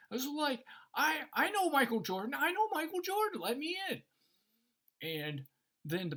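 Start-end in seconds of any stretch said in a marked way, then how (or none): 0.9-4.0 high, loud pleading demand; acted frantic protest, voice way up; desperate insistence. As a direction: beg loudly to get past the doorman. Fan demands to be let in.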